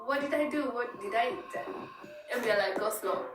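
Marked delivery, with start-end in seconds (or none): none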